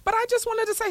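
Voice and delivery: put-on whiny kid voice. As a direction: plead like a small kid cut off. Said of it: "I'm like".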